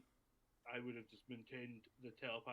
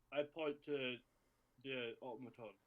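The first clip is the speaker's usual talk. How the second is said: ordinary delivery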